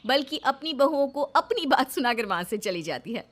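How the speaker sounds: calm storyteller reading a Hindi serial; story narration. A narrator's reading. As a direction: narrate the scene evenly, setting it up.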